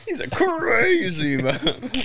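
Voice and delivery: slow laughing mockery; drawn-out giggling line.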